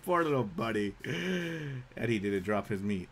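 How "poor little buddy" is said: usual speaking voice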